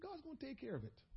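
quiet, cautious "God's gonna take care of it.". Retold or imitated. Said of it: "I cautiously say".

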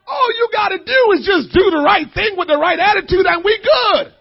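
crying out the point, loud; impassioned preaching. Acted. Preacher pleading with the congregation, crying out. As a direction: plead it like a preacher at full tilt.

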